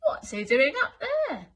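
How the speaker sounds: high, loud astonished; gasped amazement. Acted. Elephant astonished at flying Elmer. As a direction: gasp it out in wonder. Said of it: "gasped an elephant".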